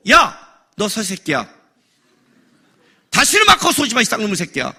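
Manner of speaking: hammed-up telling-off; mock angry cursing. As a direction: curse them out in pretend outrage. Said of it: "이렇게 말하고 싶어요".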